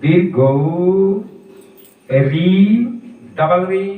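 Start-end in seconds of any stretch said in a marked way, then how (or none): 0.0-1.2 উ held very long and slow; a teacher drawing out দীর্ঘ উ; long vowel demo. Shown as a demonstration. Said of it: "টান দিয়ে উচ্চারণ করতে হয়".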